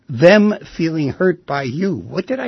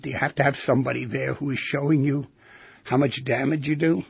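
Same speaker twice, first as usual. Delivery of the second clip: ordinary talk, nothing marked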